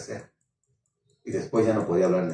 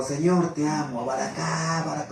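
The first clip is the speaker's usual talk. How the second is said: speaking in tongues; 5 semitones higher, 4 dB quieter than usual. Retold or imitated. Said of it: "empiezo a hablar en lenguas".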